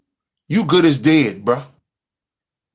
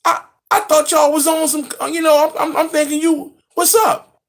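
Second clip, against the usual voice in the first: much higher, incredulous mocking tone; mock them in disbelief; 10 semitones higher than usual. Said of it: "I'm like".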